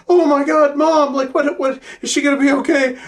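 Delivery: high, loud panicked cry of mom; mock hysterical girl; panicked daughter. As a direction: shriek it like a frantic daughter. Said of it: "and she's like".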